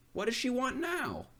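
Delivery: high, odd fish voice; magic fish voice. Acted. Fish asks the fisherman what the wife wants, high odd voice. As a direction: speak as the weary magic fish.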